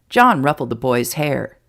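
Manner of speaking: ordinary delivery, nothing marked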